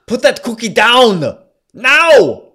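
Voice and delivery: put-on furious high Austrian-accented bellow; shouted Austrian accent. Husband ordering Ted off the cookies, yelled Austrian accent. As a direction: roar it in a thick Austrian accent. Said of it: "I have to do this motherfucking accent".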